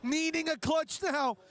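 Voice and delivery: high, loud, hyped play call; excited shout. Real, not acted. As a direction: yell it like the final clutch.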